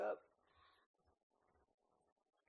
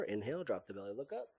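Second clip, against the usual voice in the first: ordinary talk, nothing marked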